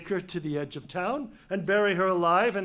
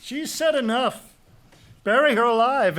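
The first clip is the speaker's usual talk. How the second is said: higher and louder, barked orders; commanding shout; 7 semitones higher, 4 dB louder than usual. Acted. King ordering guards to take Antigone, commanding shout.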